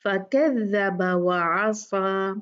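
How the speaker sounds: slow, all-light letters; slow light recitation; beautiful recitation. Shown as a demonstration. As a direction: recite lovingly and slowly. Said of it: "बहुत खूबसूरत पढ़ना है इसको".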